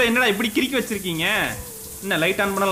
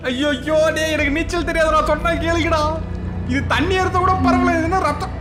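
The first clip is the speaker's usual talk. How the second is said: higher-pitched, alarmed pleading; panicked; 5 semitones higher than usual. Real, not acted. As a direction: cry out in rising panic. Panicking at rising blood in game. (பதற்றத்துடன் அலறும் குரல்)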